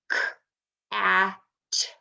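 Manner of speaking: k, a, t segmented sound by sound. Shown as a demonstration. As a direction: sound out cat phoneme by phoneme. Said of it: "in the word cat".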